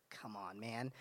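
soft exasperated mutter; frustrated groan. Real, not acted. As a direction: grumble it under your breath. Frustrated sigh at hard question.

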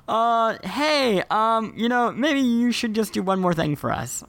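high, timid, hesitant asking; meek cutscene voice. Retold or imitated. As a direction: squeak out a sheepish favor. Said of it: "the cutscene was kinda like".

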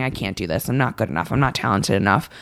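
a hint of a despairing inner critic; defeated inner voice. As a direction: let it sink low, like you half believe it. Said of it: "I've spent my whole life saying".

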